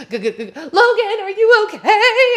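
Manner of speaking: high, loud, stammered half-laughing cry; shouting back to Logan mid-ride, terrified; panicked laughing call. Retold or imitated. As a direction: call back to your friend, scared and giggling. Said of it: "I'm like".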